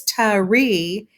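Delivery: "tree" said as tuh-REE; mistaken two syllables. Shown as a demonstration. Teacher disproving two syllables, tree split as tuh-ree. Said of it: "if we say it's tari".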